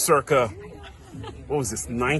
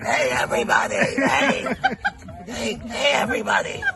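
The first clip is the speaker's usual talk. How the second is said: mock smoky-voiced gym teacher, higher, half-shouting; gym teacher impression; 7 semitones higher than usual. Gym teacher calling the class together, smoky mock shout. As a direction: imitate a chain-smoking teacher calling the class. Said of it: "sounded like she ate cigarettes".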